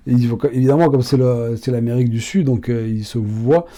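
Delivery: ordinary talk, nothing marked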